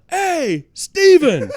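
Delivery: high, loud call across a room; imitated yell. Imitating Austin hailing a famous author across the lobby, yelling. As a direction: holler the name to grab someone far off. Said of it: "he just yells out".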